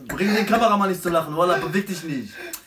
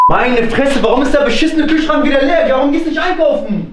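angry; 8 semitones higher, 9 dB louder than usual. Acted. Acted quarrel over empty fridge.

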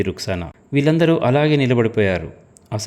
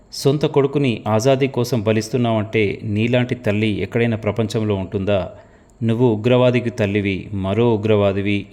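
plain reading voice

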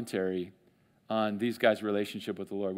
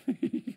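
short laugh; 10 semitones higher than usual. Real, not acted. Laughing at own joke.

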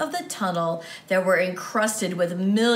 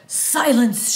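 a sharp, louder "Silence!" from the knight; knight shouts; 3 semitones higher, 6 dB louder than usual. A narrator's reading. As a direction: cut the boy off with one loud command. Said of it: "shouted the knight".

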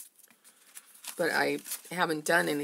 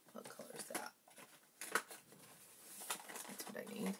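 whispered aside; 18 dB quieter, much faster than usual. Real, not acted. Whispering to herself while crafting.

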